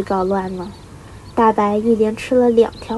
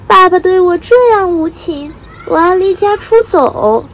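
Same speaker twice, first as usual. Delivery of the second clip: put-on high, loud indignant young bird; hurt, angry outburst; 9 semitones higher, 9 dB louder than usual. A narrator's reading. Little bird resolving to leave unfair father, indignant outburst. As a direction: protest loudly in a high, wounded voice.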